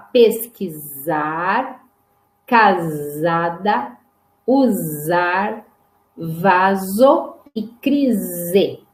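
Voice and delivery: each s in pesquisar, casada, usar, vaso, crise held as a buzzing z; som de Z. Shown as a demonstration. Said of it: "Este som, então, de z". (pesquisar, casada, usar com som de Z prolongado)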